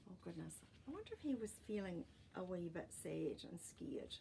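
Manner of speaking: usual speaking voice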